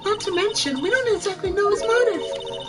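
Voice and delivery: very high put-on character voice; questioning sidekick. Sidekick questioning lawyer's theory, very high voice. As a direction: argue the doubts in a high voice. Assistant raises doubts with lawyer.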